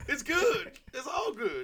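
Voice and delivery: high-pitched, chuckling aside; imitated comic squeal of approval; mock reassurance. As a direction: toss it out high through a laugh. Acted crowing after a joke.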